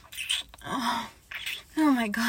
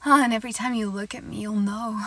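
usual speaking voice

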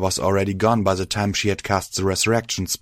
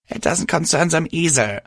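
high, hoarse upset retort; a touch of rasp in a raised voice; hoarse and raspy; 8 semitones higher than usual. A narrator's reading. Crying mare shuts out her friend, hoarse. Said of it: "her voice hoarse and raspy".

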